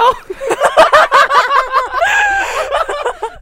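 howling with laughter; loud burst of laughter. Real, not acted. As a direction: crack up loudly.

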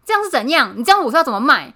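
hammed-up angry shopkeeper; imitating an angry shopkeeper. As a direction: snap at the customer like a rude shop owner. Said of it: "他就说".

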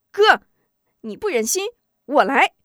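high, loud, determined outburst; 愤然高喊; fierce cry. Acted. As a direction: push him aside and take over. Angry sister steps in to kill.

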